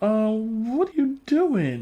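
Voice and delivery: high, slow baffled question; mock puzzled Keenan. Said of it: "Keenan is probably like".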